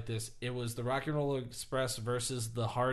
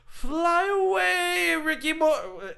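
very high, slow, drawn-out wail; mock-mournful falsetto send-off; high wailing Broken Matt Hardy; 8 dB louder, much slower than usual. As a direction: stretch it out like a grieving farewell. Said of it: "and he goes".

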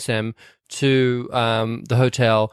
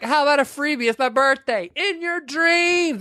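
mocking quote; 13 semitones higher than usual. Movie fan retelling a scene, high and mocking. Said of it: "He's like".

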